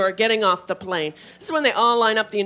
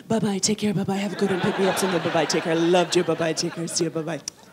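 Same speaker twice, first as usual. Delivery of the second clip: flight attendant at home; 4 semitones lower than usual. Retold or imitated. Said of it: "I'm going to the grocery store".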